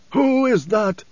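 put-on high-pitched old prophet questioning; nasal blind man. A narrator's reading. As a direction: demand it as the blind preacher. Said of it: "His voice was nasal through the stillsuit plugs".

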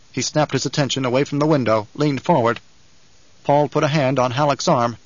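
usual speaking voice

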